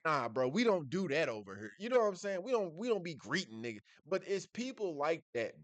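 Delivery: usual speaking voice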